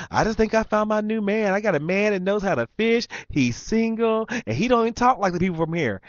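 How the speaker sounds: very high excited female voice; imitated smitten woman. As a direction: mimic her bragging about a new man. Mimicking woman bragging to somebody else.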